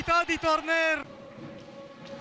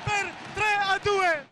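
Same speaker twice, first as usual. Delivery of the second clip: breathless italian goal commentary; 3 semitones higher than usual. Real, not acted. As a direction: count down, then cheer the win. Final countdown and victory.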